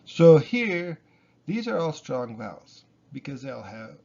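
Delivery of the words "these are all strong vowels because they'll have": ordinary delivery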